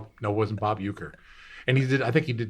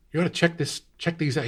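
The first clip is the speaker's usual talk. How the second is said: a higher pitched eager shopkeeper; 7 semitones higher than usual. Retold or imitated. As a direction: pitch up to sell the shirts. Shopkeeper voiced in story.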